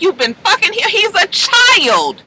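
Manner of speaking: high imitated protest, stretching the last words; outraged disbelief. Blanca confronts Pray about Ricky, retold outrage. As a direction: act out an appalled, high-pitched accusation.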